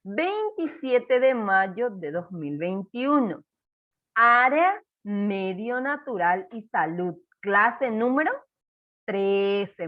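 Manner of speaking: slow and clear, word by word; teacher dictating at writing speed; slow dictation. Shown as a demonstration. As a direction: dictate the date slowly for children to write. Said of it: "Dos en la decena y siete en la unidad".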